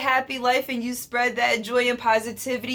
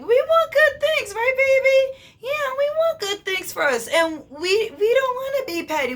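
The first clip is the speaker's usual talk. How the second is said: much higher cooing voice; high baby talk; 12 semitones higher than usual. Acted. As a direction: coo it like to a baby. Talking to herself as baby.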